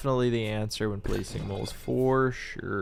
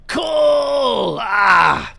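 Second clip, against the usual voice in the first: high, loud, drawn-out cry of dismay; frustrated yell; 10 semitones higher, 10 dB louder, much slower than usual. Acted. Streamer re-enacting reaction to bad rolls, exasperated yell. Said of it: "I'm yelling".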